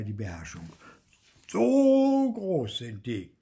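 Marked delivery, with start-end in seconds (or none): 1.5-3.3 slow, very loud, high roar; bellowed. A narrator's reading. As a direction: roar it out, losing his temper. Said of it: "brüllte er".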